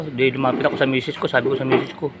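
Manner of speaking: normal talking voice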